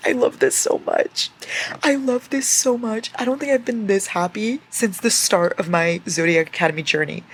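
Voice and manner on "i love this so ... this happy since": squealing with delight, higher and louder; overjoyed. Real, not acted. Reader squeals to viewers over a favorite scene, overjoyed. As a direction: gush with pure delight.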